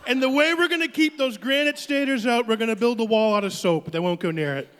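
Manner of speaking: brash rally Trump, boastful and rambling; hammed-up Donald Trump stump speech; Trump impression. Comic plays Trump announcing a border wall, brash rally voice.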